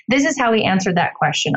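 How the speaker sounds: usual speaking voice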